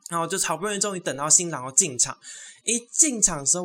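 usual speaking voice

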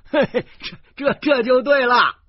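high, loud chuckling agreement; a tourist laughing at the family scolding. Acted. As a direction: cackle along, enjoying the joke. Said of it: "游客大笑说".

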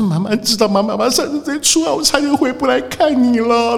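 high, hammed-up tearful telling; mock weepy mother. Mother tells her child she nearly died, hammed-up weepy voice. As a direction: overplay a survivor's tears. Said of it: "就开始一直讲，一直哭".

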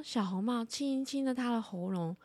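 usual speaking voice

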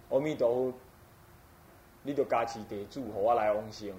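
low, subdued murmured plea in Taiwanese; weary private wish, pitched well below the lecturing voice; inner prayer. Acted. Teacher voicing a practitioner's wish for rebirth, low inner prayer. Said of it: "做一个念头说".

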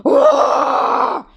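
loud high roaring cry; leaping across the cliff with a mighty roar; huge roar. A narrator's reading. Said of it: "发出 的巨大叫声". (霸王龙巨大吼叫)

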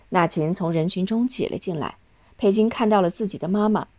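plain reading voice

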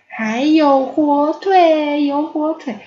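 high, loud, stretched-out delight; overjoyed discovery. A narrator's reading. Ponyo spotting ham in the noodles, overjoyed. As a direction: crow slowly over the find. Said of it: "波妞好高兴".

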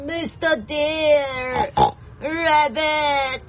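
slow, drawn-out piggy wail; whining little pig begging for shelter; squeaking pig. Acted. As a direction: stretch the plea out like a frightened pig. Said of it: "Squeaked the pig".

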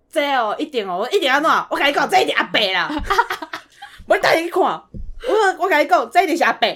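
loud, sure-of-himself dad; hammed-up know-it-all father; mock dad insisting. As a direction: crow like you've already won the bet. Dad sure the slow driver is a woman.

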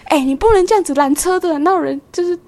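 high, loud exasperated telling-off; scolding. Retold or imitated. Bus driver scolding the passenger, high and exasperated.

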